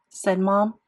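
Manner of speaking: ordinary delivery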